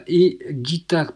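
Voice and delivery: ordinary talk, nothing marked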